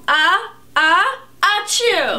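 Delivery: loud, hammy put-on sneeze; fake sneeze. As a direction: oversell a pretend sneeze. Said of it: "That was a fake sneeze".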